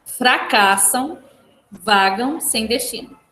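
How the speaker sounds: slow, "fracassam" and "vagam sem destino" spaced out for writing down; slow dictation. Shown as a demonstration. Teacher dictating the answer to the class, slow. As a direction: dictate it slowly so the class can copy it. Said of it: "Dois pontos".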